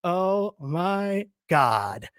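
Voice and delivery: very slow, drawn-out dismay; imitated disbelief. Woman spotting the intruder, slow stunned disbelief. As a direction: stretch each word in disbelief. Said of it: "I can see her face be like".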